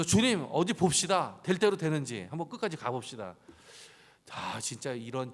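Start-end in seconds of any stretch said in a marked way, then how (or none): 0.0-3.4 a defiant loud dare, slowed; defiant challenge. Retold or imitated. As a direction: throw down the gauntlet at God.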